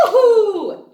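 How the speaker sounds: high, loud gasp and woohoo; excited cheer. Real, not acted. Teacher cheering as the ball is tossed, high and loud.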